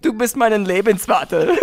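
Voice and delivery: laughing mock German accent. Showing off for teasing friends, camp German accent.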